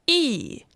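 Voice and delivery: yi falling sharply from high; fourth tone. Shown as a demonstration. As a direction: snap yi straight down. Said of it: "And fourth tone".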